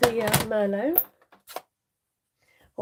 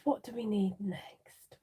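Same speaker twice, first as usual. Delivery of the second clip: whisper; 8 dB quieter, slower than usual. Real, not acted. Muttering to herself while working.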